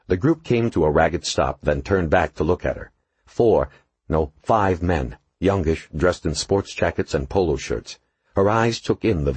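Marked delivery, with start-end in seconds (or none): none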